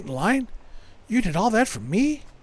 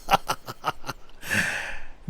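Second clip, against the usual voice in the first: breathy laugh; 6 semitones lower, 7 dB quieter than usual. Real, not acted. Laughing at the moral dilemma.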